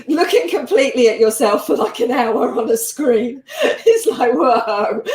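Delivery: talking through real laughter, loud and high; amused, breaking into laughs as you speak; laughing. Real, not acted. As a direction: laugh your way through it, voice lifted. Laughing about staring at oneself on screen.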